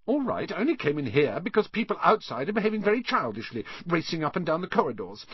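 put-on high, quick, sniffy girl; huffy self-defence in a high, hurried voice. A narrator's reading. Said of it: "said Hermione in a sniffy voice".

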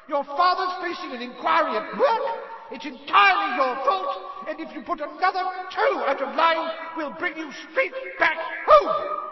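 shrieking, very high mother's tirade; furious yelling. A narrator's reading. Mother's howler berates son for taking the car, furious yelling. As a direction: bellow a scolding at full force, screeching. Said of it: "Missus Weasley's yells".

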